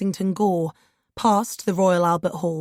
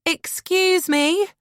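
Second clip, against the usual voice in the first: drawn-out, high call to get attention; 11 semitones higher, much slower than usual. Acted. Passenger calling slowly through the taxi glass partition. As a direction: stretch it out and pitch it up to be heard.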